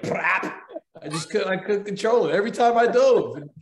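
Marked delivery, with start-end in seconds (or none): 0.0-0.6 short spluttering fart noise; imitated fart. Actor showing what happened each time he dove, imitated fart. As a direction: make a quick lip-burst fart sound. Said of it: "it was like".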